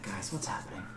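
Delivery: ordinary delivery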